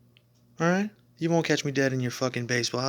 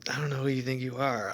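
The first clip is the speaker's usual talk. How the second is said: ordinary talk, nothing marked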